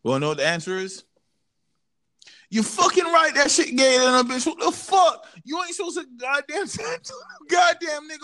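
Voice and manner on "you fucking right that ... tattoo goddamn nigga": high, loud outraged yelling; hoarse-losing shout. Real, not acted. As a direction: yell it till your voice cracks. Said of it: "I lost my voice in the middle of that".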